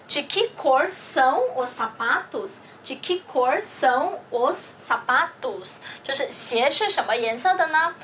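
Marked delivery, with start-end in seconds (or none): 0.1-5.8 slow demo repeat. Shown as a demonstration. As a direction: repeat the question slowly and clearly. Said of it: "这个鞋怎么说". (慢速清晰重复问句)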